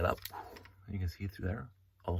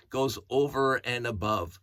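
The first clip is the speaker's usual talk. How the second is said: ordinary delivery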